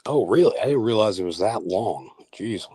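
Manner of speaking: ordinary talk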